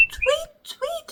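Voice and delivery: bird tweet. Shown as a demonstration. Bird sound demo.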